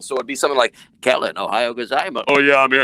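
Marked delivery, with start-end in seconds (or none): 1.0-2.2 put-on caller voice. Shown as a demonstration. Caller greets radio host on the phone, put-on voice. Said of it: "I would do the voices". 2.3-2.8 loud voice chiming in; second caller voice. Shown as a demonstration. Second man chimes in on the call, put-on voice. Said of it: "so it sounded like two people".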